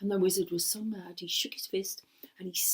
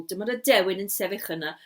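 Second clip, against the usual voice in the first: plain reading voice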